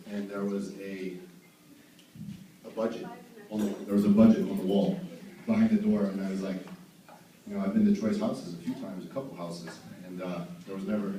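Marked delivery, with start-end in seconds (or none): none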